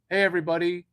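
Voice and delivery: loud call to everyone; a loud hail to the audience. Real, not acted. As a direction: call the whole chat over loudly. Calling out to viewers.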